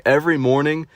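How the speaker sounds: ordinary delivery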